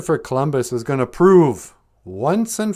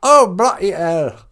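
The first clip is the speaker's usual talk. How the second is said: hammed-up British exclamation, slow; a comic Queen in a mock British accent; 6 semitones higher, 5 dB louder than usual. Exasperated Queen Elizabeth answers Columbus in a mock British accent. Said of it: "Queen Elizabeth was all like".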